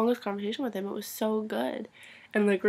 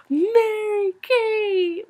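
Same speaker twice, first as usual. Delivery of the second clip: high, loud, stretched-out squeal; mock fangirl cry of a name; imitated fangirling; 12 semitones higher, 9 dB louder, much slower than usual. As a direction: greet someone like a starstruck fan. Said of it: "he was fangirling over me".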